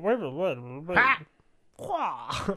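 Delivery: loud goofy grunts, high; silly voice sounds. Retold or imitated. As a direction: blurt out silly cartoon noises. Mimicking funny game voice sounds.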